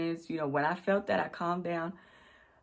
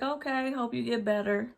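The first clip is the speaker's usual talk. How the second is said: higher, mock-sugary boss voice; mock sweet boss; 6 semitones higher than usual. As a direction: imitate the boss's fake concern. Boss voiced in retold call.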